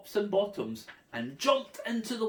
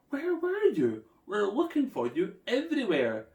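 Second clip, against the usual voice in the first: worried parent voice; 6 semitones higher, 3 dB louder than usual. A narrator's reading. Parents find lost children.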